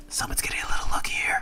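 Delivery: conspiratorial unvoiced whisper; mock whisper. To viewers about the lucky opponent, a mock whisper. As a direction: whisper it as a sly aside. Whispered aside after saying it aloud.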